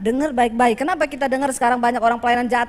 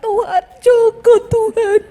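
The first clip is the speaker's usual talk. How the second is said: slow, loud, high hammed-up prayer; mock pleading prayer, slow and loud; mock fervent prayer; 6 semitones higher, 6 dB louder, slower than usual. Mock praying for another's husband.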